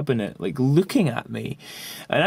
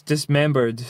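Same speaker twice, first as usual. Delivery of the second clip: ordinary delivery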